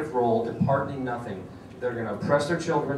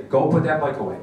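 a louder, firm order; firm command; 3 semitones higher, 4 dB louder than usual. Shown as a demonstration. Parent ordering daughter about the bike, firm and louder. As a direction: tell her, don't ask her. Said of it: "you gotta go and say".

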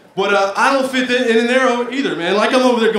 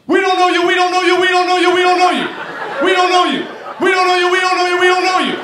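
barking dogs; 9 semitones higher, faster than usual. Acted. One dog to another in a fight, high yapping. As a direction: bark the words over and over like agitated dogs. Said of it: "this is pretty much what I understood".

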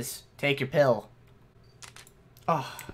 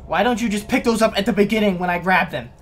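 loud, high frustrated rant; angry yell; 7 semitones higher, 9 dB louder than usual. Real, not acted. Yells at the game.

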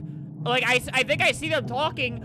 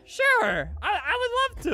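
higher-pitched eager acceptance; thrilled, high-pitched yes; very excited; 10 semitones higher, slower than usual. Acted. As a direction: jump at the offer excitedly. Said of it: "got very excited over that".

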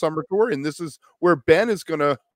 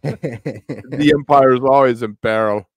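slow, booming movie-trailer narrator; dramatic trailer voice; 2 semitones lower, 6 dB louder, slower than usual. Shown as a demonstration. [name] shows off a voiceover voice, slow dramatic trailer read. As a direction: intone it like an epic trailer. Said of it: "See, you can change up your voice".

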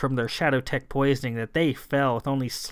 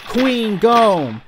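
loud, raised angry call; voice laden with fury; 9 semitones higher, 8 dB louder than usual. A narrator's reading. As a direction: call her name full of fury. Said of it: "a voice laden with fury".

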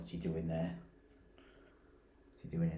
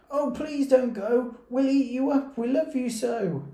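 very high, loud, slow begging monster voices; pleading monsters; 9 dB louder than usual. A narrator's reading. Wild things begging Max to stay, high pleading monster voices. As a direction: beg like big soppy monsters. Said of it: "the wild things cried".

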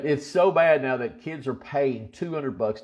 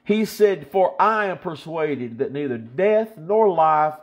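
raised, rhythmic scripture preaching; 5 semitones higher, slower than usual. Acted. Preacher reading Paul's list to believers, rhythmic. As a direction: build the list of nors steadily.

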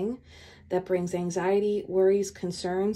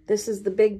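ordinary talk

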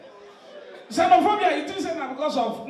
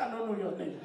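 small humble low voice; meek reply; 4 semitones lower, 8 dB quieter than usual. Retold or imitated. His meek reply to her demand.

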